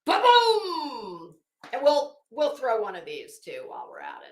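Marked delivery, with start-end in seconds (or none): 0.1-1.4 gleeful ba-boom. Real, not acted. Gleeful punchline exclamation.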